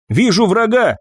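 high, sharp battle report; urgent alert. A narrator's reading. First operator alerts Ева mid-battle, calling out urgently. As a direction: call out a sighting to the crew.

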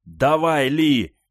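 loud, high urgent command; shouted. A narrator's reading. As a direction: shout at the driver to go. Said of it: "крикнул Люкер".

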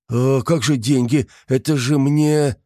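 high, slow, plucking up courage; a nervous try at boldness; timidly bold. A narrator's reading. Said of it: "осмелел журналист".

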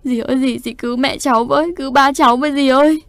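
rising, pleading cry that ends in a loud wail; crying for help. A narrator's reading. Little sister begging her aunt to save her parents, crying out. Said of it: "Tiếng kêu cứu của em gái tôi".

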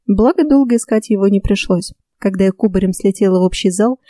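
plain reading voice

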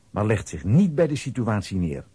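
normal talking voice

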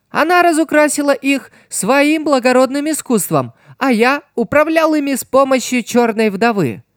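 high, put-on villain voice; character voice. A narrator's reading. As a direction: voice him high and smug. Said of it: "подал голос Райсен".

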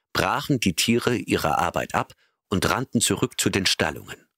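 ordinary delivery, nothing marked

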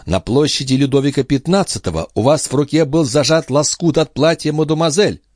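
high-pitched accusing warning; threatening. A narrator's reading. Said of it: "Тем же угрожающим тоном предупредила".

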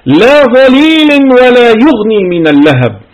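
ترتيل مرتفع وبطيء; Quran recitation. Acted. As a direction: lift the voice and intone the verse slowly. Recites the verse.

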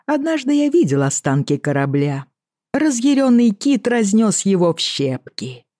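casual lilting Irish sea captain; offhand, in a light Irish brogue; Irish accent. Acted. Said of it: "с певучим ирландским акцентом".

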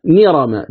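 "نرم" as nirama, n replacing the hamza; read with noon. Shown as a demonstration. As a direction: begin "نرم" on a clear ni. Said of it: "تقرأها نرم بالنون".

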